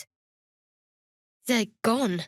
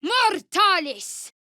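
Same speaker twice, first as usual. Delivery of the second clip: high, slow, loud spell cry; drawn-out shouted incantation; 8 semitones higher, 4 dB louder, much slower than usual. Acted. Casting a spell, slow shouted incantation. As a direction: stretch the spell word out.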